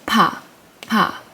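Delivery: "pa" popped out twice; Korean p sound. Shown as a demonstration. Teacher demonstrating "pa" to the class. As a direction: model "pa" clearly. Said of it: "makes like a p sound".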